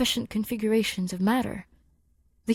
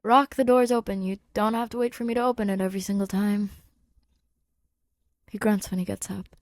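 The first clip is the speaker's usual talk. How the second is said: usual speaking voice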